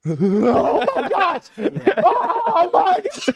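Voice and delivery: drawn-out, high, loud mock scream; delayed freak-out. Friend suddenly realizing about the mouse, belated freak-out. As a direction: wail it slowly in belated panic.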